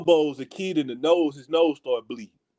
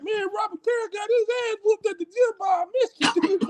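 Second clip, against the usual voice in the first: high, put-on gossiping voice; mock the crowd spreading the news; teasing himself through imagined gym talk; 14 semitones higher, faster than usual. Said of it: "be like".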